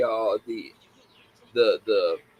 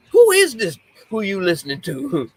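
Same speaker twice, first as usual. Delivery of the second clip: high, loud mock mom barging in; startled mom demanding who's talking; mock angry mom; 7 semitones higher, 5 dB louder than usual. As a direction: play the mom catching the kid. Voicing mom bursting in.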